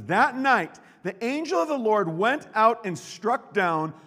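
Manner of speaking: loud, high dramatic telling; dramatic scripture reading. Acted. Preacher reading the angel's strike to the crowd, dramatic. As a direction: build to the climax.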